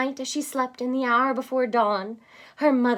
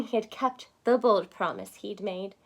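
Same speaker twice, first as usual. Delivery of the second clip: ordinary delivery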